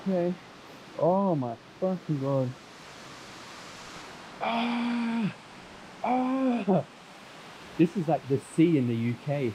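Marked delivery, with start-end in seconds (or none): 4.4-6.8 high yelp from icy water; sharp shriek at the cold. Real, not acted.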